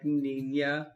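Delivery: "niña" slowly with the Spanish ny sound; demonstrating how the tilde changes the sound in "niña"; Spanish pronunciation. Shown as a demonstration. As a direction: say "niña" carefully, ni-nya. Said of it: "in Spanish".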